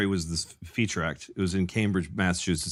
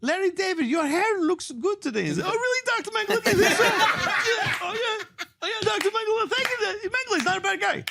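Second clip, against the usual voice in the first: very high mock fawning voice, laughing through it; Mengele and fawning Larry. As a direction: squeal the thanks like a flattered fool. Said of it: "he does this thing of".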